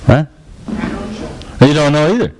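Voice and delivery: ordinary talk, nothing marked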